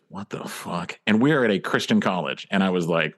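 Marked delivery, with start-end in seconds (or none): 0.1-1.0 quiet, stunned swear under the breath; shocked whisper. Retold or imitated. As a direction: mutter it low so nobody nearby hears. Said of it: "she goes".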